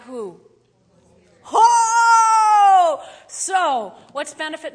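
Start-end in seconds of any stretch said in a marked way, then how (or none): 1.5-3.0 high, loud cry of joy; preacher whooping with joy; ecstatic shout. Acted. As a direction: burst out with a shout. Preacher's exclamation.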